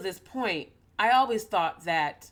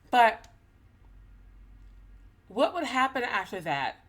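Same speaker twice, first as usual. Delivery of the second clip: ordinary delivery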